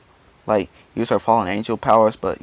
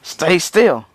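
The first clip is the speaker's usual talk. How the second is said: acted exasperated yell, higher and louder; pissed off; 8 semitones higher, 6 dB louder than usual. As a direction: yell it, fed up with a dodging opponent. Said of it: "starting to get a little pissed".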